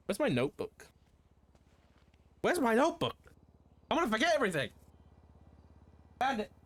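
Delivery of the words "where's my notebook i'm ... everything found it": much higher, hammed-up fluster; mock panic. Streamer hunting for his notebook, mock panicked squeak. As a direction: squeak it out like you've lost everything.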